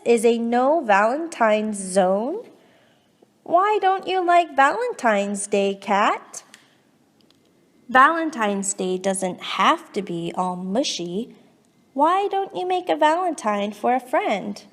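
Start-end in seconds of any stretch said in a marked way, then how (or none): none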